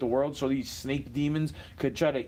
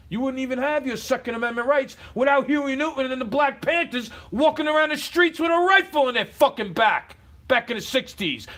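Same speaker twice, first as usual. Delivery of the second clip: loud, much higher shouting; furious shout; 7 semitones higher, 6 dB louder than usual. Real, not acted. Vlogger shouts history at his critics, furious and loud. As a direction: yell it at them, furious.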